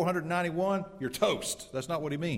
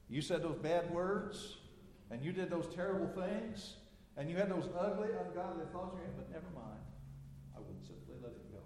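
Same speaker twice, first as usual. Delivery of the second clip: tender voice of God; 5 semitones higher, 10 dB quieter than usual. Acted. God voiced forgiving sinners.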